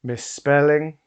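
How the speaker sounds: loud, slow stage command; booming showman decree; commanding hypnotist. Acted. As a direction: lay down the hypnotic order.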